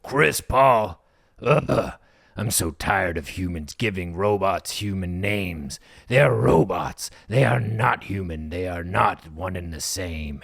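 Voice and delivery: slow, weary scornful guard; fed-up jailer lecturing slowly; grumpy jailer. Acted. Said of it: "and he's like".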